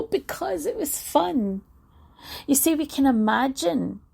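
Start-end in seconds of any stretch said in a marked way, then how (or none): 0.0-1.6 playful reply. Retold or imitated. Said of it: "And they go".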